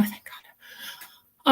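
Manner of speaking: a relieved whisper under the breath; whispered aside. Real, not acted. Relieved aside about coworker leaving.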